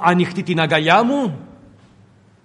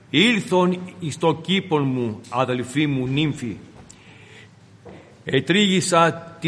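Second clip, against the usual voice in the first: scripture reading; 4 semitones lower than usual. Acted. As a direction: read the verses measured and devout. Reads Song of Songs aloud. (σεμνή ανάγνωση της Γραφής)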